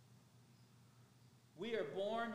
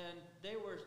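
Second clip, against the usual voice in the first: ordinary talk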